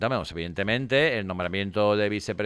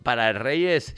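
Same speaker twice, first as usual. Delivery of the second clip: normal talking voice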